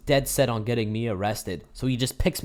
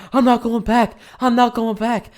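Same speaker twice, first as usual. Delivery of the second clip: much higher and louder, shouting it twice while fleeing; panicked yell; 11 semitones higher, 8 dB louder than usual. Retold or imitated. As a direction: yell it like you're escaping the cops. Friend yelling while running away.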